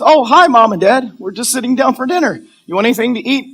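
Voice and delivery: put-on high, cheery girlfriend voice; breezy daughter greeting. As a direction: chirp innocently to Mom and Dad.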